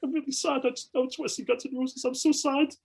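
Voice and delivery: high, whiny mock-sad wail; mock sad fan. Fan hearing Guns N' Roses may cancel, mock whine. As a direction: whine it like a heartbroken kid. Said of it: "I'm panicking".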